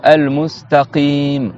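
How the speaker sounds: slow al-mustaqim with throaty q; deep qaf in al-mustaqim. Shown as a demonstration. Teacher gives an example of qaf, demonstrating deep q slowly. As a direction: stretch al-mustaqim, q far back. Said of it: "presque à l'entrée de la gorge".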